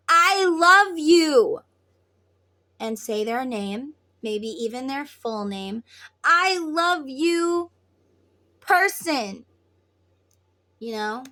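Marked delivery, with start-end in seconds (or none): 0.1-1.6 drawn-out, high, loud I love you; loud declaration. Acted. Speaking into a twin's ear from afar, loud loving declaration. 6.2-9.4 slow, high, loud declaration of love. Acted. Telling your person by full name, loud loving declaration.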